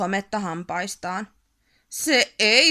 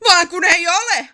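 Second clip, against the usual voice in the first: much higher, loud angry bellow; troll roar; 8 semitones higher, 11 dB louder than usual. A narrator's reading. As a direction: roar it at the postman. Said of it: "Ärmätti karjaisi". (karjaisu postinkantajalle)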